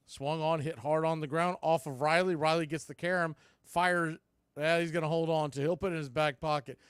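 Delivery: usual speaking voice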